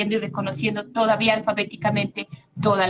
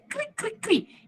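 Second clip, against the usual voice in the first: high, soft chirping like a cricket; high soft cricket chirps; 11 semitones higher, 6 dB quieter, slower than usual. Shown as a demonstration. Teacher imitating crickets.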